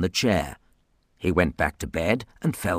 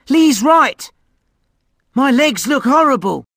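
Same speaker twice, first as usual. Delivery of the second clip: put-on boy voice; 7 dB louder than usual. Ross agreeing, boy voice.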